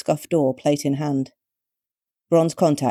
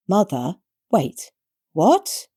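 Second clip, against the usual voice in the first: dazed confusion; 4 semitones higher, much slower than usual. A narrator's reading.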